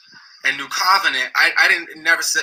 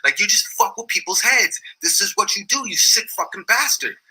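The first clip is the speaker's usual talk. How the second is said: raised, higher-pitched accusing rant; heated accusation; 5 semitones higher than usual. Retold or imitated. Speaker retelling how he went off on Polite, pitched higher. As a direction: unload it on him as the heated rant. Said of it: "and I'm like".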